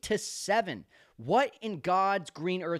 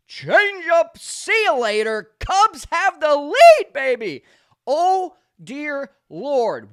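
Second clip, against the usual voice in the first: loud, high, stretched-out yelling; loud, drawn-out cry of joy at the go-ahead homer; ecstatic home run call; 9 semitones higher, 14 dB louder, slower than usual. Real, not acted. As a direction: scream it like the lead just flipped.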